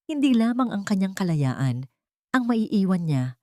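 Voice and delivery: ordinary delivery, nothing marked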